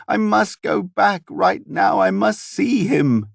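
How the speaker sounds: high, slow frantic pleading; desperate crying. A narrator's reading. The daughter begs to return to her father, high, slow, desperate. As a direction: beg to go back, voice near breaking. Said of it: "she cried".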